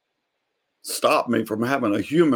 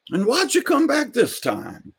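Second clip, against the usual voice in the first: put-on much higher old man's question; old neighbor asking; 13 semitones higher than usual. Neighbor on the porch asks Carlos, high old man voice. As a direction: ask it high and curious.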